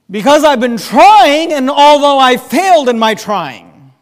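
loud, rising preacher's cry, hammering each although; full-force preaching. Acted. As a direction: preach it out, pleading for the congregation. Preaching the prayer's two arguments.

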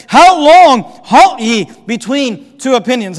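loud, slow, hammered-out question; exasperated prophet's challenge; frustrated. Acted. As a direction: demand it slowly, word by word. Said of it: "hear the frustration in Joshua".

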